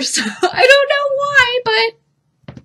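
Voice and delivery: laughing, high and slow. Real, not acted. Telling viewers about her odd habit, laughing. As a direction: giggle through the puzzlement. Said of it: "laughing at herself".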